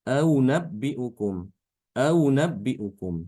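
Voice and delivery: aunabbiukum slow, second hamza melted between hamza and w; softened second hamza. Shown as a demonstration. Teacher modelling Ibn Kathir's tashil of aunabbiukum. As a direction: recite aunabbiukum slowly, sliding the second hamza into w. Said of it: "Jadi dibacanya".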